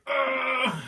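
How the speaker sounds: high, loud grunt of pain as if stabbed. Acted. Reviewer acting Toby getting shanked, a high pained grunt. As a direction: let out a hurt yelp.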